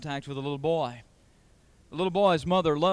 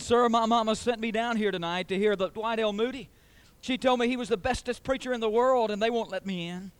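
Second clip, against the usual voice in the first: higher-pitched, lightly voiced young boy; retold little boy; 6 semitones higher, 4 dB louder than usual. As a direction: lightly imitate a child explaining himself to a stranger. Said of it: "this little boy standing there crying".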